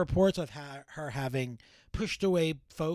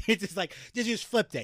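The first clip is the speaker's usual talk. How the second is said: ordinary talk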